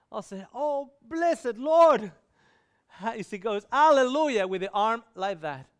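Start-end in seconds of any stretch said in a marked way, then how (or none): 0.5-2.1 loud, high, drawn-out cry to God; acting out an overwhelmed exclamation; exclaimed praise. Retells his cry of praise. 3.7-4.5 loud, high hallelujah cry; acting out a joyful exclamation; jubilant praise. Retells cry with arm raised.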